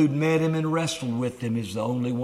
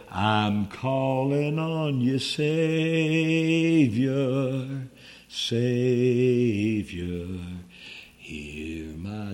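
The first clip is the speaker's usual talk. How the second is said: slow drawn-out plea; much slower than usual. Retold or imitated. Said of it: "and they'd say".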